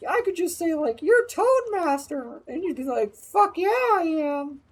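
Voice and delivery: very high put-on voice; high-pitched imitation. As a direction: push it way up into a mock falsetto. Voicing Noodle's retold quip.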